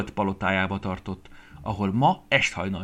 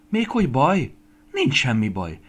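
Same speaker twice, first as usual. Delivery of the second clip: loud, eager reply starting high; excited; 6 semitones higher, 7 dB louder than usual. A narrator's reading. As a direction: brush off the worry and rush on. Estike excited by her sudden idea. (izgatottan, hangosan, sietős lelkesedéssel)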